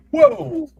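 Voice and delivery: startled as it slips; real startled yelp; surprised whoa. Real, not acted. Something slips while showing figure.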